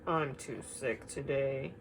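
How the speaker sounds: soft, slow, weary reply; sick bear. Acted. Sick Bear turns down his friends, softly. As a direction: sound too ill to play.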